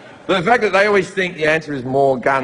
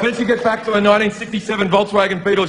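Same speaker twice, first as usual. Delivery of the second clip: normal talking voice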